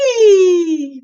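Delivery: high, loud delighted squeal; gleeful squeal. A narrator's reading. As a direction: squeal with giddy joy. Cat drunk on catnip.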